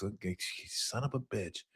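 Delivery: usual speaking voice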